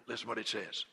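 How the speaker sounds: ordinary talk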